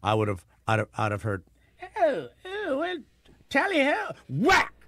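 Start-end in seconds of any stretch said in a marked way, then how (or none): 2.0-4.1 very high, hooting mock-British voice; hammed-up tipsy Brit squealing out of a pub; drunken British soldiers. Would-be sniper overhearing British soldiers leave a tavern, mock drunken Brit. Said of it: "a drunken, uh, British soldiers or Tories". 4.3-4.7 sudden high mock shot noise; imitated gunshot. Would-be sniper picking off a British soldier, imitated shot.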